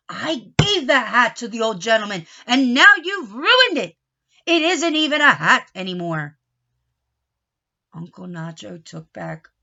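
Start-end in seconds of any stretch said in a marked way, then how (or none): 0.1-6.4 loud scolding; angry. A narrator's reading. Uncle Nacho scolds the boys, loud and angry. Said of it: "Uncle Nacho was angry".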